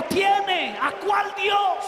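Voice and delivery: mocking shout. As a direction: throw his word back at him, yelling. Lieutenant mocks and shouts back. (grito burlón y furioso)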